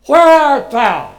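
loud, slow, high call; big booming where art thou. Shown as a demonstration. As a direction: call out like a commanding voice. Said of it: "With what tone of voice".

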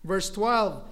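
normal talking voice

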